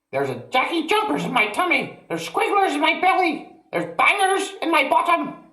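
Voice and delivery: put-on very high, far-off old woman's wail; wailing grandma. A narrator's reading. Grandma wailing about her belly, very high. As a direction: wail like a tormented old hag.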